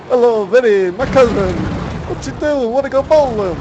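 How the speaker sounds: cartoon Italian accent, high and loud; bad Italian accent. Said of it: "Dave and Dan do horrible accents".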